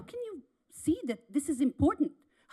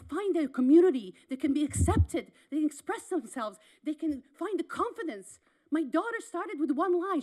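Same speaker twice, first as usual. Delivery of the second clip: normal talking voice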